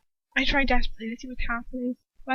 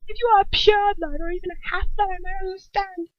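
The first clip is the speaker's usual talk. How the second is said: much higher scornful tirade; raging Bellatrix; 9 semitones higher than usual. A narrator's reading. Bellatrix scorning Lucius for his lover. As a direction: pour out scorn.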